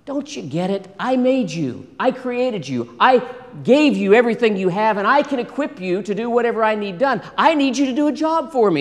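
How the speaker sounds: acted exasperated insistence. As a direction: press the point to a reluctant servant. God insisting to a reluctant Moses.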